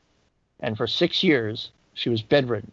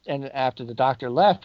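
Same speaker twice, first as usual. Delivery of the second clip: normal talking voice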